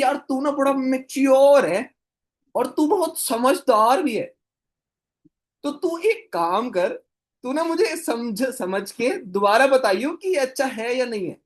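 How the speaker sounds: butter him up, drawn out and comic; comic high flattering voice of a mentor; same funny tone. Retold or imitated. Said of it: "और same tone में भाई".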